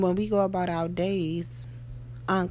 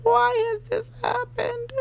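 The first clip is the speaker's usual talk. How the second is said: much higher and a bit louder, whining; a high, pleading repeat; high plaintive why; 4 dB louder than usual. Pretend. Asking herself again why it happened, high and plaintive. Voicing upset self-talk.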